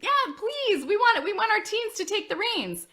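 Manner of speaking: high, excited imitation of eager parents. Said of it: "some of you are thinking".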